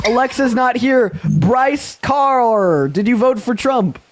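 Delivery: high, drawn-out hype calls; hype announcer. Acted. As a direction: crow the tally out to the crowd. Host hypes the tally of voters.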